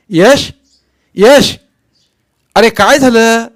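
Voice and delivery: loud, high desperate shout. A narrator's reading. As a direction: yell his name as he runs off. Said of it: "मी ओरडलोच". (जोरात ओरडून हाक)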